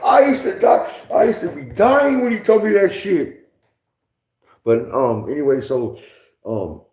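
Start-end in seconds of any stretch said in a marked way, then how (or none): 0.0-3.5 high, louder words through laughter; laughing. Real, not acted. Recalling the tattoo joke, laughing through words. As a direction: talk while still laughing.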